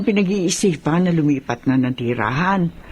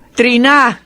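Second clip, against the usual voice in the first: loud call across the house; 2 semitones higher, 6 dB louder than usual. Acted. Mother calling her daughter to come pack, loud call. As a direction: call her name sharply, louder.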